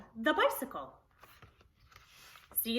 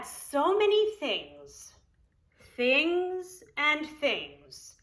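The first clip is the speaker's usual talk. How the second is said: drawn out; 4 semitones higher, much slower than usual. Acted. Drawn-out list in storytelling.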